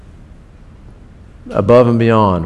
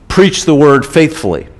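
normal talking voice

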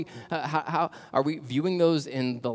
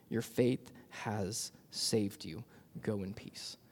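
tender; 5 semitones lower, 7 dB quieter, much slower than usual. Acted. Jesus gently speaks to healed woman.

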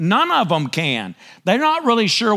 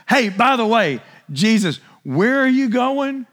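eager Peter; 5 semitones higher than usual. Retold or imitated. Preacher voices Peter questioning Jesus.

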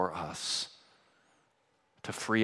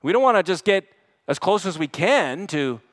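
loud, raised, driving exhortation; forceful preaching; 7 semitones higher, 7 dB louder than usual. Real, not acted. Preacher urging Christians to live holy lives, forceful. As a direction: press the point on the congregation.

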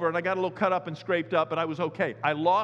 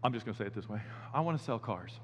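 much lower and softer, worn out; weary confession; 7 semitones lower, 8 dB quieter, faster than usual. Retold or imitated. Pastor telling God he wants to quit, quiet and defeated. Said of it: "You know what, Lord?".

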